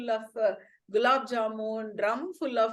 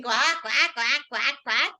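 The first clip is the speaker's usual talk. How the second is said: loud put-on duck quacks; duck quacking; 3 dB louder than usual. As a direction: quack like a panicked duckling. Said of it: "And Ping was shouting".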